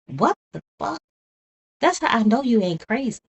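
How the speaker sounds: stunned and disgusted; outraged disbelief. Real, not acted. Streamer reacting to the killer's request with outraged disbelief.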